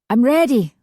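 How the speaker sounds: calls out keen to board first; eager cry. A narrator's reading. A passenger calling out eagerly to the boat. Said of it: "someone cried".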